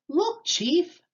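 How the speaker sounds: high, excited call; shout. A narrator's reading. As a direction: call the chief's attention. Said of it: "Joe shouted".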